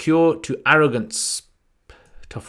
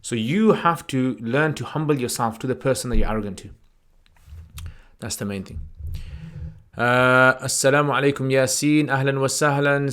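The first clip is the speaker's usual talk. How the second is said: ordinary talk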